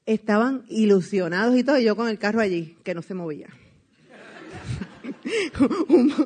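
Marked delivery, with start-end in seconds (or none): 4.5-5.8 high burst of laughter. Real, not acted. Laughing to the audience about the car that wouldn't move. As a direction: laugh at the absurd situation.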